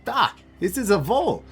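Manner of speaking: put-on loud-ish high foreign accent; high hammed-up accent; Vadim's horrible accent. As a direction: announce it proudly in a bad high-pitched accent. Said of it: "it sounds like he's saying Vol".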